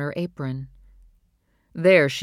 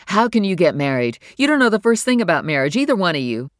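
louder, exasperated outburst, fast in the middle; 4 semitones higher, 7 dB louder than usual. Acted. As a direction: at the end of her patience, let it burst out. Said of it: "pushed to the limit of her patience".

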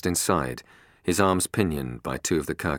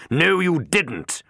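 much higher, loud and slow; enraged boss yells at lying guard; furious shout; 14 semitones higher, 7 dB louder, much slower than usual. A narrator's reading. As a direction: explode at the liar. Harper slaps the guard.

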